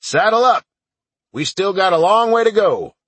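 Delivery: loud shouted command; shouting. Acted. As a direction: bellow it across the yard. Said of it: "and shouted".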